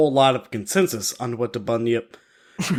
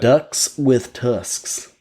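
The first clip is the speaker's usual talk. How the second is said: very slow, drawn-out enunciation; vocal warm-up; 2 semitones lower, much slower than usual. Pretend. Podcaster mimicking newsreaders before air, slow warm-up. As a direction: stretch each word like a newsreader warming up. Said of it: "news people should say before they go on air".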